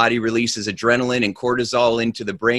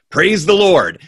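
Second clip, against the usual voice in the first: laughing, higher and louder; 6 semitones higher, 6 dB louder than usual. Acted. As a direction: let it out on a laugh. Guy finally says the go word.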